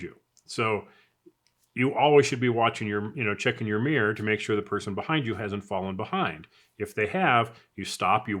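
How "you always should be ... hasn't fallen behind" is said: ordinary delivery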